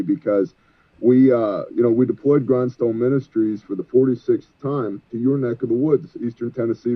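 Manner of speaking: normal talking voice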